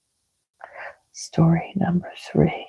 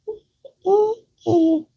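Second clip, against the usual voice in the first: very high thin squeak, groaning; squeaky mouse groan; 13 semitones higher than usual. Acted. Trapped mouse groaning in a high squeak. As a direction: squeal like a small sad mouse. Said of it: "groaned poor Squeaky".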